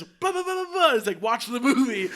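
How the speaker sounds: very high, loud mock translator; translating kid. Kid passes the order on in Chinese, high loud mimicry. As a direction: parrot the order in an excited high voice. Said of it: "my Chinese friend is translating".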